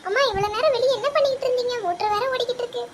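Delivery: put-on high, child-like voice asking mom. Child asking mother why she stayed out so long, high child voice.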